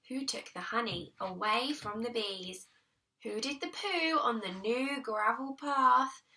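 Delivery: ordinary delivery, nothing marked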